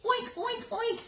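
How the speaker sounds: high squealing oinks; piglet squeal. Acted. Piglet squealing at mama pig. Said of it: "the piglet squealed".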